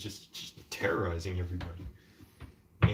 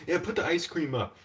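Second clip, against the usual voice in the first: calling out; 8 semitones higher than usual. Retold or imitated. Landlord calling to people nearby.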